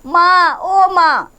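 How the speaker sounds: a hammed-up moan of pain calling mom; loud groaning.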